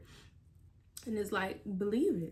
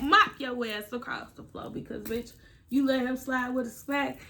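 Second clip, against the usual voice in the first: ordinary talk, nothing marked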